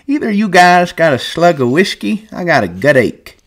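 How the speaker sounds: put-on high, cracked old swamper voice; an old man shuffling in, chatty; old Candy. A narrator's reading. Old Candy greeting the men, high old voice.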